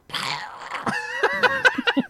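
high, drawn-out creature screech; long piercing mock scream; imitated monster scream. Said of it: "like, a Sianitar scream".

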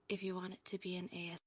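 very soft, hushed ASMR delivery; za cicho. Shown as a demonstration. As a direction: speak so softly you barely carry. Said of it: "You need to talk louder".

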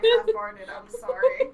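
high, loud, cracking up; laughing apology. Retold or imitated. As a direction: squeal the apology through giggles. Retold embarrassed apology amid laughter.

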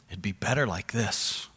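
voiceless tempting whisper. Acted. Opposer's tempting lie quoted.

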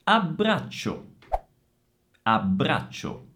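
deliberate ABBRACCIO, held BB and CC; double consonants. Shown as a demonstration. Teacher models two doubles, careful ABBRACCIO. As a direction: give both doubles time in ABBRACCIO. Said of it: "Doppia B e doppia C".

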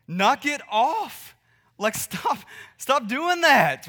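slow, high, loud scolding; mock angry yell. Parent scolding a crawling baby: loud, high, slow yell. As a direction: drag it out like a fed-up parent. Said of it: "do we just go yell at them like".

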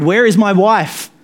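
high, loud anguished cry; desperate cry. A narrator's reading. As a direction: cry out for his lost wife in terror. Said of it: "Again, he cried".

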